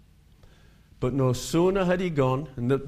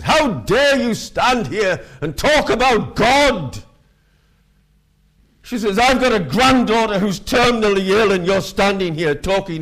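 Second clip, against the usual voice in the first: put-on irate old lady; 6 semitones higher, 6 dB louder than usual. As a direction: scold him like an outraged grandmother. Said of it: "an irate old lady".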